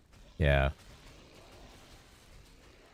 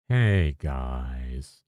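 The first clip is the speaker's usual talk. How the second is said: Fubgun impression; 6 semitones lower than usual. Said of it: "It's too, too up".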